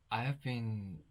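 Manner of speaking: ordinary talk, nothing marked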